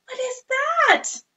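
high slow amazed question; surprised question. Pretend. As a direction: wonder at the reveal. New animal reveal.

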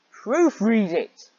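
loud, slow, stretched-out demand; drawn-out furious proofread it; exasperated yell. Real, not acted. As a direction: bellow it at the author. Ranting at author.

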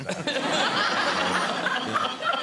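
high, loud burst of laughter; big real laugh. Real, not acted. Host laughing at his son's phrase, loud high laugh.

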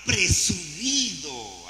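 presumido stretched very slow; drawn-out word. Real, not acted. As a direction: draw out presumido slowly. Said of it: "se me llegó la palabra".

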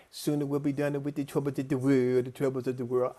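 title run together in mock dialect, TH turned to D. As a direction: parody the spiritual's words, "de troubles". Mocking the song title he hated.

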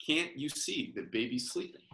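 ordinary delivery, nothing marked

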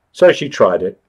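plain reading voice

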